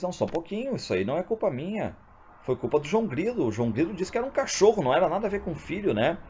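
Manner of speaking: voz bem mais aguda e rápida, padre se desculpando; defenda-se às pressas, em tom alto; padre aflito. Acted. (padre se explicando ao bispo, voz aguda e apressada)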